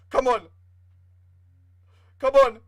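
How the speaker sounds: loud pleading come on; will the team to hold on; urging shout. Real, not acted. Urging team in stoppage time.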